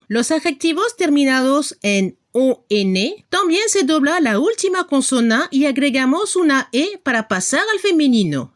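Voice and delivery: normal talking voice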